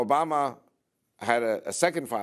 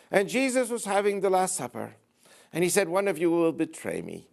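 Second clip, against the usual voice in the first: put-on higher-pitched Irish accent; 8 semitones higher than usual. Father O'Malley preaching at the High Mass, Irish accent. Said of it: "with his Irish accent".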